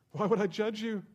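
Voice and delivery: loud, high, slow proclamation; full force preaching as God. Acted. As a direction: thunder out God's answer, drawn out.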